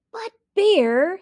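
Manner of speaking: high, loud protest; innocent hare. Acted. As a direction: whine innocently.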